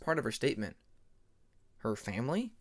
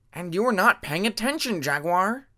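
higher, louder heated shout; angry shout; 6 semitones higher, 7 dB louder than usual. Acted. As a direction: yell back mid-argument. Cliff quarrel.

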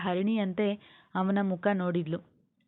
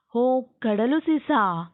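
slow, high exclamation of surprise; loud, lifted, drawn-out surprised question; 4 semitones higher, 5 dB louder, slower than usual. A narrator's reading. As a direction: stretch it out, louder, in astonished recognition. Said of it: "ಅವನಿಗೆ ಆಶ್ಚರ್ಯವಾಗಿತ್ತು".

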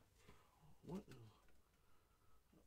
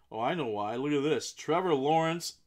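getting louder and higher into a thrilled reveal; building excitement; 3 semitones higher, 10 dB louder than usual. Real, not acted. Breaker revealing a star card, excited. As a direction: build to a big, loud name call.

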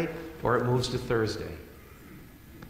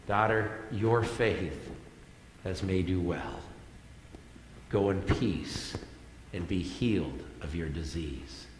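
tender blessing; 4 semitones lower, 6 dB quieter than usual. Retold or imitated. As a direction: bless her quietly, like a father. Jesus speaks tenderly to the healed woman.

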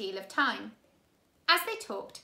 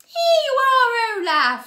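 loud, much higher eerie woman's voice; 12 semitones higher, 14 dB louder, slower than usual. A narrator's reading. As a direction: drawl it out theatrically.

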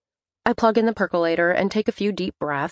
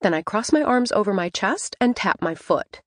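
usual speaking voice

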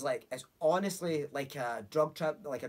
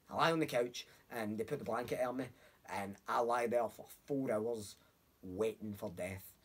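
ordinary delivery

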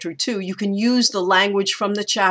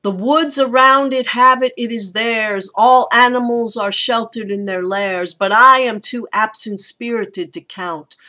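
higher, emphatic recitation of verse; poetry reading; 4 semitones higher, 5 dB louder than usual. Acted. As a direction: read the stanza aloud with strong beats. Reads the poem's second stanza.

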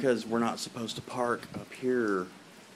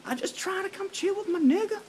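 mock voice. Mock voice, very high.

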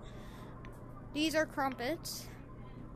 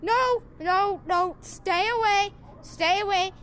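loud higher pleading no no; alarmed shout; 5 semitones higher, 8 dB louder than usual. Real, not acted. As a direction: push something away in a panic. Fending off game creature.

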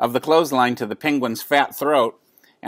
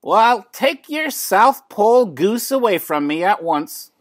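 much higher, huffy demand; indignant neighbor lady; 9 semitones higher than usual. A narrator's reading. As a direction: order him off in a huff. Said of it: "said Missus Callahan".

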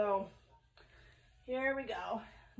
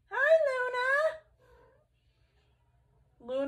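talking to a pet; 14 semitones higher, 6 dB louder, much slower than usual. Real, not acted. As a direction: coo up high at the kitty. Greeting her cat sweetly.

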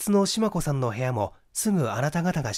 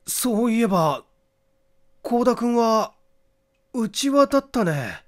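much higher, slow voice dawning on a memory; high, drawn-out voice of someone suddenly remembering; struck by recollection; 10 semitones higher, 6 dB louder than usual. A narrator's reading. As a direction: let the realisation hit as you say it.

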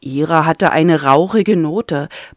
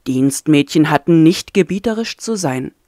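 ordinary delivery

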